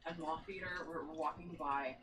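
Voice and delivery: ordinary talk, nothing marked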